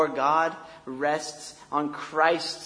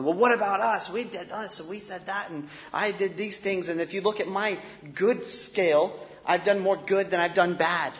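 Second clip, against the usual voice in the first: higher-pitched, indignant mock protest; grumbling complaint; 5 semitones higher than usual. Rejected people at the gate protesting to be let in, mock grumbling. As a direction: imitate people grumbling in a raised, aggrieved voice. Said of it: "all the people are there grumbling".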